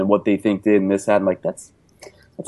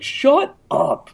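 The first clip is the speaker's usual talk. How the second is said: a much higher, sharp mock retort; mock shut up; 13 semitones higher than usual. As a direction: snap it out in a put-on high voice. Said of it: "I'm like".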